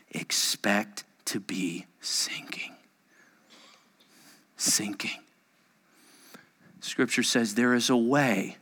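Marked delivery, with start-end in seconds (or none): 0.1-5.2 slow, low, near-whispered; low, hushed warning; grave hush. Real, not acted. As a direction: let the words sink away softly. Solemn point let sink downward.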